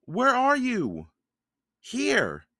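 high, drawn-out searching call. A narrator's reading. Children calling to each other in the forest, high drawn-out calls. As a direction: call across a distance, slow and high.

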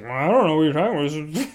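innocent put-on voice; feigned innocence. Classmates covering for him, mock innocence. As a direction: play dumb for the whole class.

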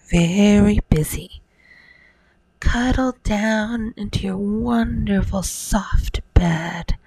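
ordinary delivery, nothing marked

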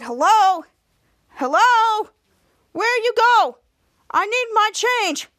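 put-on high, calling old woman voice. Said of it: "the old woman said".

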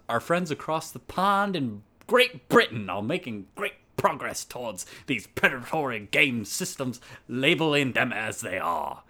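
mock British accent drifting into Australian; wobbly British-Australian accent. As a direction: start British, slide clumsily towards Australian. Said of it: "I don't know what accent that was. It kinda started, like, British and then turned kind of Australian".

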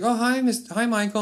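a hint of a starstruck wife; wife's voice. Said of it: "she's like".

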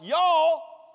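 loud high Southern "Y'all"; big Southern "Y'all". Retold or imitated. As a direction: call out "Y'all" with a Southern drawl. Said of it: "he's Southern".

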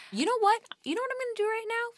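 high, excited burst; a sudden idea, said high and eager; sudden excitement. Real, not acted. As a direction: jump up with a new idea.

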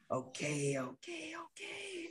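breathless whispered okays; panicked whisper. Real, not acted. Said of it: "I'm panicking".

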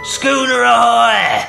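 high, long-drawn far-carrying shout; ship hail. A narrator's reading. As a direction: call out across the water, loud and slow. Hail from the dark sea.

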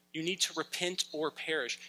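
ordinary talk, nothing marked

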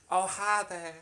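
put-on American accent. Player introducing the harlot to the table, put-on American accent. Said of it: "I don't know what accent I'm gonna go with".

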